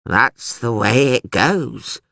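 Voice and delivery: put-on slow, drawn-out old man's mumble; slow resigned old prisoner; mumbling old man. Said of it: "mumbled the hairy old man".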